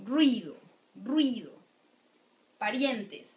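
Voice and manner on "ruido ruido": ruido glided into one syllable, rwi-do. Shown as a demonstration. Teacher to students, showing ruido as one syllable. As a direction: run the u and i together. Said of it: "in one syllable".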